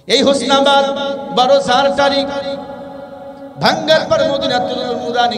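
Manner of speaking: high, loud sermon shout; preaching at full force. Acted. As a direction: proclaim it to the crowd. Loud announcement.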